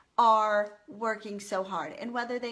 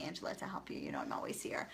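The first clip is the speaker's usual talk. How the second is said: soft, much lower intimate whisper; 11 dB quieter, faster than usual. Real, not acted. Offering help to a struggling viewer, a soft whisper. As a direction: lean in and whisper it to her.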